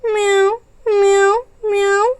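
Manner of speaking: high, short meows; cat at the window begging for food; cat meowing. Acted. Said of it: "कितनी ज़ोर ज़ोर से चिल्ला रही है".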